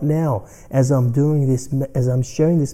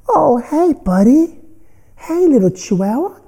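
put-on much higher, louder baby-talk to a tiny dog; cooing at a dog; 5 dB louder than usual. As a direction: coo sweetly at the little chihuahua. Greeting barking chihuahua on bike.

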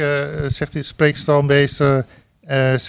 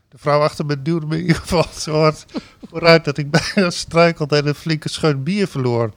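ordinary talk, nothing marked

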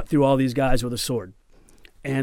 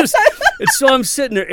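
laughing through the words; 13 semitones higher, 7 dB louder than usual. Real, not acted. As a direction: chuckle while setting the scene. Laughing while telling.

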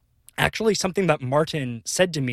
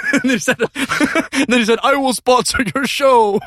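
high, breaking into laughter; laughing; 9 semitones higher, 6 dB louder than usual. Real, not acted. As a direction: laugh through the punchline. Laughing through the punchline.

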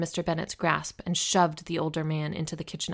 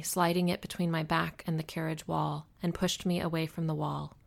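ordinary delivery

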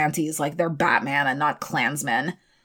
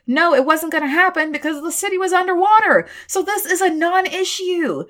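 high, fast, incredulous ranting; exasperated rant; 8 semitones higher, 3 dB louder than usual. Real, not acted. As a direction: throw up your hands and rant at them. Mocking argument, high excited rant.

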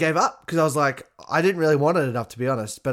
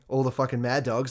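normal talking voice

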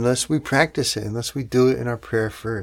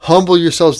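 Humble yourselves, raised and forceful; proclaimed command; 6 semitones higher, 9 dB louder than usual. Acted. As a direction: ring out Humble yourselves high and loud. Preacher proclaims Peter's command.

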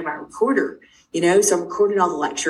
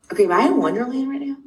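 higher, quicker mock-bewildered question; retold disbelief; 7 semitones higher, faster than usual. Mother retelling her bewilderment at the facilities, mock disbelief. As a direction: toss it out fast and high, incredulous.

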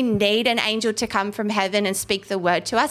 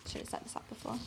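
unvoiced muttered self-reproach; whispered aside; 22 dB quieter, much faster than usual. Real, not acted. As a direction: say it under your breath. Aside while finding the verse.

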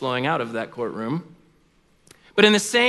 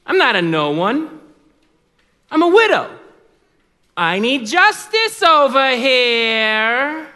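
indignant widow; 7 semitones higher, 5 dB louder than usual. Acted. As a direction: insist on being heard as the feisty widow. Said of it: "And she would say".

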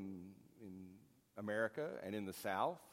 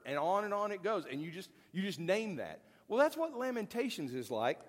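fervent preaching; 7 semitones higher than usual. Real, not acted. Preacher telling listeners the blues names pain, fervent preaching. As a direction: preach it high, like singing out the pain.

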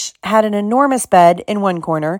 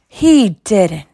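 understated growl of disbelief; 2 semitones higher, 4 dB louder than usual. A narrator's reading. Hagrid growls his outrage to Hermione about Malfoy. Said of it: "he growled at Hermione".

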